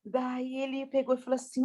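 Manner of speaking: ordinary talk, nothing marked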